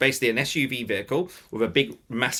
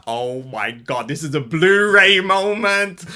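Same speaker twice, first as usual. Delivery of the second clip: slow, drawn-out, louder and higher on the second half; awestruck nerding out; 4 semitones higher, 6 dB louder, much slower than usual. Retold or imitated. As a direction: savour it like a fan living a dream. Nerding out on set.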